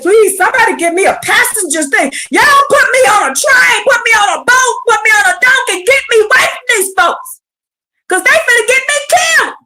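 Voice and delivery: panicked prophet begging to escape; desperate Jeremiah. Acted. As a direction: beg for any ride out of town. Jeremiah voiced, pleading to flee.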